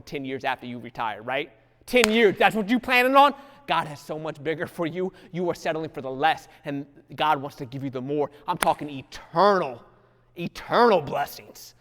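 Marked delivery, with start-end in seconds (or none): none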